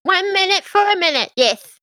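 brisk little servant imp. Acted. GM voices imp.

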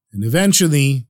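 ordinary delivery

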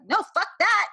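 high, loud acted outburst; defiant refusal. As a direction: shout it down in defiance. Acting out standing up for teammates.

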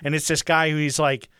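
ordinary talk, nothing marked

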